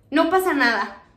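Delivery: chuckling as she calms her friend; laughing reassurance. Retold or imitated. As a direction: say it through a light laugh. (riendo, tranquilizando a Oli)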